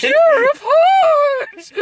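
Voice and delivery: drawn-out, very high, loud shriek; screechy unicorn. Acted. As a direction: stretch each word into a piercing cartoon squeal. Mimicking screeching unicorns from a cartoon.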